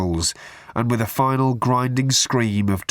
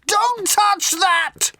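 very high, drawn-out panicked yell; much slower than usual. A narrator's reading. Ford stops Arthur replacing the phone, yelling. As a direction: stretch it into a slow, high screech. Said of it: "yelled Ford".

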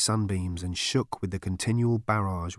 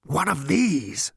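enthusiastic; 7 semitones higher, 3 dB louder, slower than usual. Acted. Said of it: "said the machine with enthusiasm".

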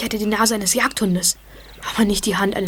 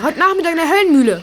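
excited; 6 semitones higher, 6 dB louder, faster than usual. Acted. Excited explanation.

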